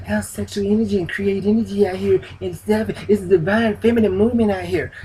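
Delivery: lightly parodying a spiritual influencer; mocking those women. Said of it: "bad body women talking about sexual energy".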